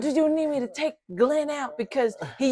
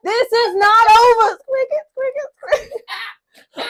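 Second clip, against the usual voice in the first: laughing mock threat; 11 semitones higher, 9 dB louder, slower than usual. Raccoon flees, laughing.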